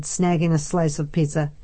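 ordinary delivery

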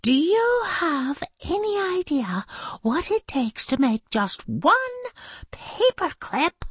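high-pitched outraged lecture; indignant teacher. Acted. Teacher lecturing spoilt children, high and indignant. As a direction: demand it, pitched right up. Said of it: "Missus Jules was too angry".

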